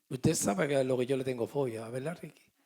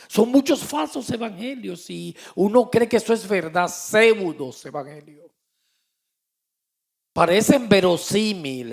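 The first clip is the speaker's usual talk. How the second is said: loud, fervent pulpit voice; fervent preaching; 4 semitones higher, 10 dB louder than usual. Acted. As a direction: thunder the message to the congregation. Preaching at full force. (predicando con fuerza desde el púlpito)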